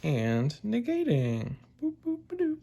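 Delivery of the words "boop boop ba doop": high, soft sung boops while writing; playful boop noises. Acted. Teacher writing on board, scatting high boops. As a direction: hum the boops lightly up high.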